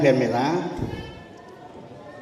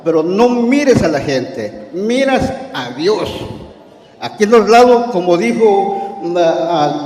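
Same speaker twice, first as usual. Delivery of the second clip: raised, fervent preaching voice; 2 semitones higher, 4 dB louder than usual. Acted. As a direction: preach it out to the congregation. Preaching about the church.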